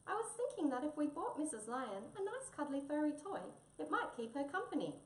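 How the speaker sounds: high-pitched, tiny mouse voice; gentle little mouse. Acted. Mouse proposes a toy for the lioness, kindly. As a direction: suggest it as a small, kindly mouse.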